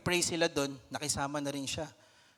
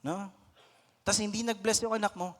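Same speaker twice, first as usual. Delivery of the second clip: ordinary talk, nothing marked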